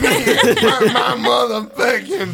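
loud hammed-up sobbing wail; shout-cry it out, half laughing; mock crying shout. [name] wails to the panel about the beating, loud mock sobbing.

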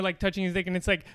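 ordinary talk, nothing marked

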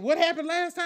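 normal talking voice

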